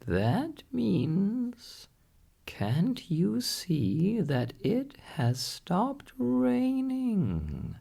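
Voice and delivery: high, squawky parrot voice, slow and patient; a wise parrot setting the doctor straight. A narrator's reading. Parrot correcting the doctor, in a high parrot voice.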